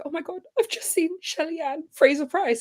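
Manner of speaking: imitated happy crying. Athlete posting about seeing her idol, imitated happy crying. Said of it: "and she's crying".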